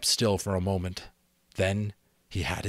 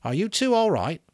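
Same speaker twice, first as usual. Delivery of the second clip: high, louder worried question; checking on friends after a fight; anxious call; 11 semitones higher, 5 dB louder than usual. A narrator's reading. Boy calling after fight.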